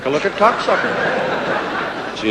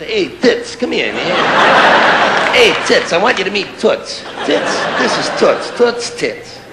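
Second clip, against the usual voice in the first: buddy greeting; 4 semitones higher than usual. Pretend. Acting out friendly introductions.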